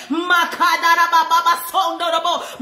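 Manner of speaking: shouted tongues; speaking in tongues. Real, not acted. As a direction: cry out in tongues. Praying in tongues while preaching.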